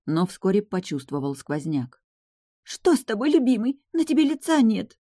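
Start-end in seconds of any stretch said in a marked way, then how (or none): 2.6-5.0 put-on high, girlish, anxious voice; worried bride. Bride to her pale groom, worried. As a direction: fret over your beloved, pitched high.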